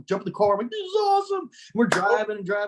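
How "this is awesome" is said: high mock thrill. [name] riding with the boys, high and thrilled. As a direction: squeal it like a happy kid. Retold excitement in car.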